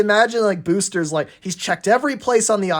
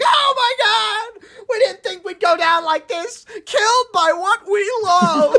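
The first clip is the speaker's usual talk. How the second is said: hammed-up screaming tankers; 4 dB louder than usual. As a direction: wail like doomed melodramatic tankers. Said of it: "the tankers behind him are screaming".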